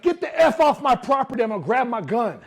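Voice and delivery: loud, high furious threat from the neighbor; angry threat. Retold or imitated. Neighbor yelling at salesman to leave. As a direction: bark it like a threatened homeowner.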